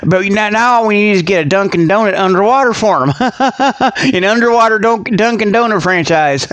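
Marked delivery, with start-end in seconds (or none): 3.2-4.1 quick chuckle; laugh. Real, not acted. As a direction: laugh at the donut idea. Laughs at own joke.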